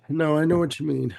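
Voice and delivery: normal talking voice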